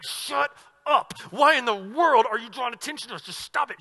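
a wife snapping at her husband to shut up; annoyed wife. Pretend. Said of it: "This guy's wife would be like".

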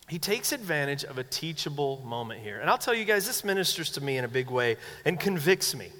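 ordinary talk

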